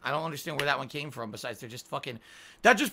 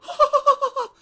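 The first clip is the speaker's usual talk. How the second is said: high squealing laugh; a sudden shrill burst of laughter; real laugh; 10 dB louder than usual. Shown as a demonstration. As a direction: let out a quick high laugh. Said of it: "So when I laugh and I go".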